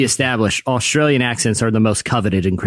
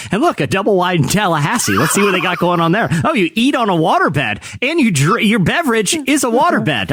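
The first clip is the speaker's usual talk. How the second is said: higher-pitched mock amazement; hammed-up fascination, pitched up; mock excitement; 6 semitones higher than usual. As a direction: play a wowed food-show viewer. Acts out an intrigued TV viewer.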